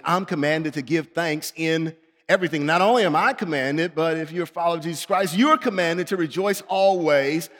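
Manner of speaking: normal talking voice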